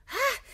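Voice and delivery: sharp high scornful "Ha!", loud. Acted. Célimène scoffing at her prude rival, loud scornful ha. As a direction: spit out a mocking laugh.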